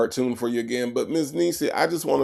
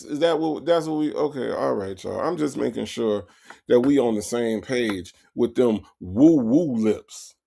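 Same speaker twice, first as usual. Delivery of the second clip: ordinary delivery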